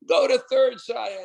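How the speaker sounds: high, eager calling; excited urging. Retold or imitated. Team urging Shaya to second, high excited calls. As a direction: push him on to the next base.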